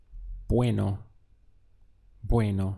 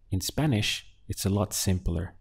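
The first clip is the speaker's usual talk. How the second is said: ordinary talk, nothing marked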